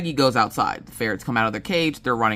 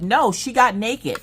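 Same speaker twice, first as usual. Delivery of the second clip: put-on kid voice; 8 semitones higher, 3 dB louder, slower than usual. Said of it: "Maggie's like".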